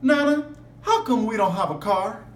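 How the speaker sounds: lively read-aloud voice; expressive storybook reading; story narration. A narrator's reading. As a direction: read it to the class like a picture book. Reads picture book to class.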